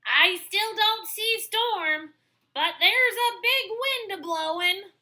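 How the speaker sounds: high, loud call from above. Acted. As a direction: call down from the window. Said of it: "Duck called down again from the window".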